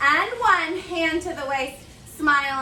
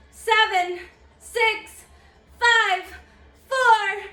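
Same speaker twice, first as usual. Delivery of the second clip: normal talking voice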